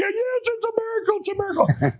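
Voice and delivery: retelling a joyful cry to the flight attendant; overjoyed cry. Retold or imitated. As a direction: burst out that it's a miracle. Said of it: "I'm almost in tears".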